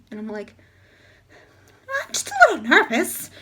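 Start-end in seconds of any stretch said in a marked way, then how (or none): 2.0-3.3 nervous. Retold or imitated. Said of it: "I say that as I'm, like, drenched in sweat".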